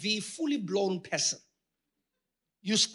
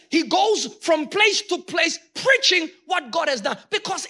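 full-force preaching; 8 semitones higher, 5 dB louder than usual. Acted. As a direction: thunder it out to the congregation. Preaching peak.

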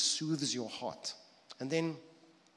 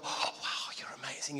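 breathy, whispered amazement of an adoring dog; awestruck dog; 4 dB quieter than usual. Pretend. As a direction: gasp it in hushed, mock wonder. Voicing an awestruck, grateful dog.

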